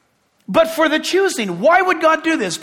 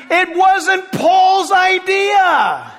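higher, drawn-out mock yell at the screen; retold yell; 4 semitones higher, much slower than usual. Preacher retelling yelling at the rabbi on TV, exasperated mock yell. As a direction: protest slowly and emphatically, retelling a yell. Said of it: "I'm yelling at my T V screen".

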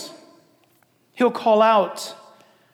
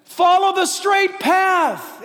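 high, drawn-out proclamation; announcing to a nation; 9 semitones higher, 4 dB louder, much slower than usual. Acted. The Lord announcing to a nation that departed, high proclaiming call. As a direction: call it out loud and slow. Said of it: "he announces to a nation that's departed from him".

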